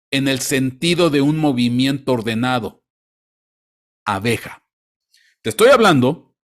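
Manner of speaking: ordinary delivery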